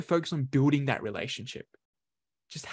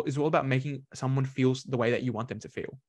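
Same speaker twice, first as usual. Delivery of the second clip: usual speaking voice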